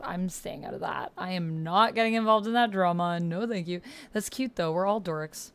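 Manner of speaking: usual speaking voice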